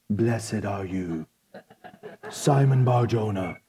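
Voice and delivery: slow, hushed, low and solemn; movie-version Jesus. Acted. Preacher imitating movie Jesus blessing Peter, slow low solemn voice. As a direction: intone it like a reverent film Messiah. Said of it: "he probably sounds like some movie version".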